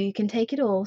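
ordinary delivery

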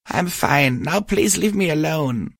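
high-pitched, upset brush-off; upset mare. A narrator's reading. Crying mare tells her friend to leave, upset.

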